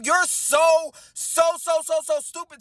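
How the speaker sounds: SO repeated high and loud; high, loud, insistent repeats of "so"; exasperated disbelief. Retold or imitated. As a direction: hammer each "so" at a high pitch. Exasperated at his confession.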